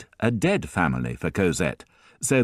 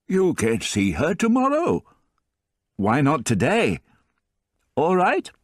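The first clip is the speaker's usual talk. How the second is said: put-on higher old grandfather voice, tender and teasing; fond old grandfather; 6 semitones higher than usual. A narrator's reading.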